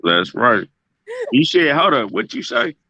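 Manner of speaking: usual speaking voice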